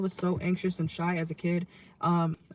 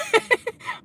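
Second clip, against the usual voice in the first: surprised laugh; 7 dB louder than usual. Real, not acted. Laughs at own phrasing.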